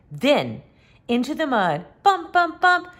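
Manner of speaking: ordinary delivery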